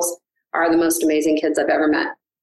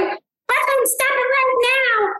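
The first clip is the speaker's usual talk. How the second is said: much higher, squeaky puppet dog voice; silly dog voice; slower than usual. Acted. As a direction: scold the child in a high cartoon dog voice. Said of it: "wants us to talk to her in a puppet voice".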